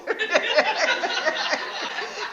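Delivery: a high, breathy giggle; laugh. Real, not acted. Laughing while telling the wife's knowing reply. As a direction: let the laugh spill out. Laughing at her own story.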